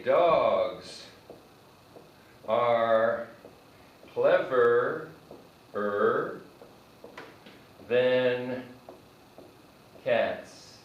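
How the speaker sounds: slow, word by word with pauses; slow model sentence. Shown as a demonstration. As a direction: lay each word out for the class. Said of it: "I can actually do this".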